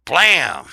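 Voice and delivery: loud, high cartoon "Blam!"; mock gunshot. Elmer Fudd firing his gun, a loud shouted bang. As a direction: bark out a gunshot noise. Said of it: "Elmer Fudd would be like".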